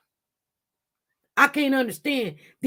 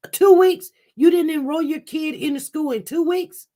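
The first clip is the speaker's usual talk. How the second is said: high, loud incredulous outburst; can't believe what she hears; outraged disbelief; 6 semitones higher, 6 dB louder than usual. Real, not acted. Livestreamer to viewers, outraged disbelief.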